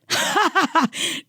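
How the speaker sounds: high, put-on scoffing laugh; re-enacted incredulous laugh; mock laugh. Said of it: "And I laughed, and I went".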